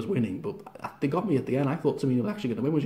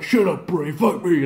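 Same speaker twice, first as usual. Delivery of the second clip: louder, fed-up retort; mock irritation; 3 semitones higher, 5 dB louder than usual. Braun telling Bray to shut up, louder mock annoyance. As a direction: snap back at the taunting rival.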